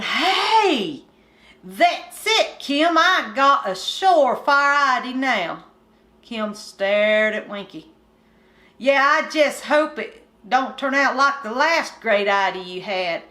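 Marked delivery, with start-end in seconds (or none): 0.0-5.6 high, loud burst of discovery; excited country boy. Acted. Winky telling Kim his great idea, excited and higher. 8.8-13.2 put-on bored country boy. Kim doubts Winky.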